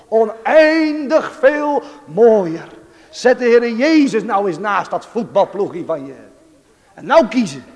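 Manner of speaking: high, loud pulpit exhortation; fervent preaching. Real, not acted. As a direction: preach with rising fervour, stretching key phrases. Exhorts congregation at full force.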